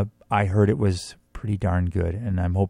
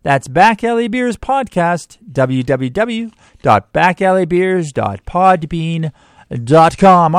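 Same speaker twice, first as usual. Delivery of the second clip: ad read; 7 semitones higher than usual. Acted. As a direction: read it like a radio ad. Sponsor read on radio.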